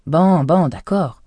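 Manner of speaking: grudging, annoyed give-in to the cat; agacé, un peu plus fort; irritated. Acted. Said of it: "dit-il avec irritation".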